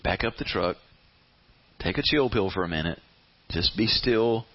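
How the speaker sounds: low, measured reassurance with pauses; calming. Acted. Jesus telling frantic Martha to be still, calming. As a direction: settle someone down, phrase by phrase.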